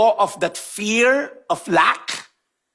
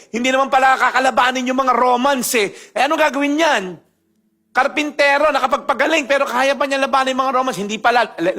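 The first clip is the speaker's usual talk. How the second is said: ordinary delivery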